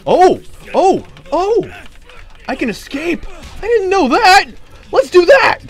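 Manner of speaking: very high, loud frantic yelling; panicked screaming. Real, not acted. Escaping and caught in game.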